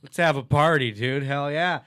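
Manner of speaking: loud partying shout; rowdy drunk cheer. Retold or imitated. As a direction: whoop it up, laughing drunk. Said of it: "they're like".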